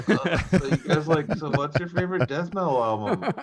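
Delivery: high-pitched mock of himself, half laughing; groggy interviewer self-parody. Puffy-faced guest host asking band questions, mock groggy. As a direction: play up a struggling hungover host.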